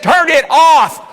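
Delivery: drawn-out high, loud mock shout; retold insistent shout. Wife orders husband to stop the bed again, drawn-out shout. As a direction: shout it word by word as the fed-up wife.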